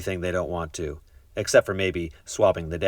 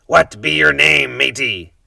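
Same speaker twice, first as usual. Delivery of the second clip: pirate asking; 5 semitones higher, 8 dB louder, slower than usual. A narrator's reading. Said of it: "the head pirate asked".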